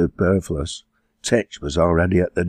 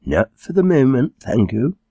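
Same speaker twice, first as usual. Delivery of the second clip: put-on higher, affected middle-class accent; 7 semitones higher, 4 dB louder than usual. A narrator's reading. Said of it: "a rather middle-class accent".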